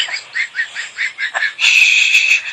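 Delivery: a very high, airy burst of laughter; shrieking laugh. Real, not acted. Host losing it after mocking the storyteller, shrieking laugh. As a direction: shriek with laughter at your own jab.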